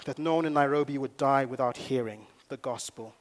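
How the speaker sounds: usual speaking voice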